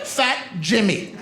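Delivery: slow exaggerated Spanish mutter; mock mother-in-law. Comic mimicking his mother-in-law, slowly. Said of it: "Like here".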